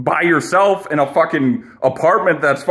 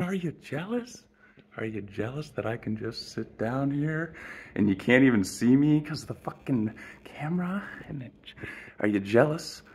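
much softer, half-whispered taunt; teasing hush; 5 semitones lower, 12 dB quieter than usual. Real, not acted. Taunting the jealous viewers behind the camera, softly and slyly. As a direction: lean in and needle them quietly.

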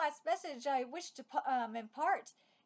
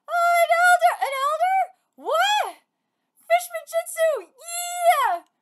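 loud, high, keyed-up cries; excited shouting; 10 semitones higher, 12 dB louder, slower than usual. Acted. Reading battle lines aloud, shouting excitedly. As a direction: hype it like the fight just turned.

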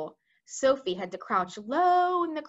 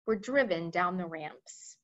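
ordinary delivery, nothing marked

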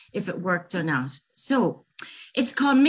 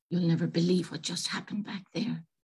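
softer, whispered and upset; tearful whisper; 3 semitones lower, 6 dB quieter than usual. Acted. Girl telling a new friend she was tricked, tearful whisper. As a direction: whisper it through hurt.

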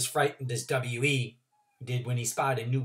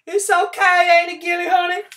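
put-on old country woman; 12 dB louder than usual. Trotter voice.

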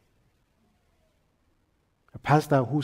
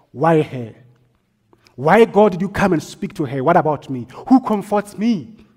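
crying out; 3 semitones higher, 7 dB louder than usual. Retold or imitated. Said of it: "I was praying and crying".

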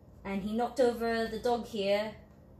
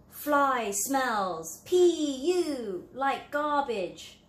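taunting; 5 semitones higher, 5 dB louder, much slower than usual. Acted. Bee insults fly in picture.

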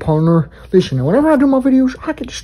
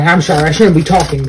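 ordinary talk

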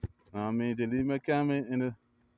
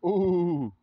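loud ooh; excited ooh; hyped ooh; 7 semitones higher, 6 dB louder than usual. Real, not acted. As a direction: cheer the shot. Reacting to a shot.

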